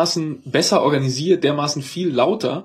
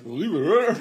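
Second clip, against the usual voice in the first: put-on Bavarian accent; 5 semitones higher, slower than usual. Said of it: "Das klang halt so bayerisch".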